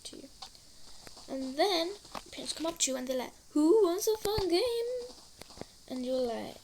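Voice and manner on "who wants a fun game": high, loud, slow mock-cheerful parent; hammed-up parent calling everyone to play; cheery parent voice. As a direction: sing it out like an overeager mum. Parents voiced in kid's rant.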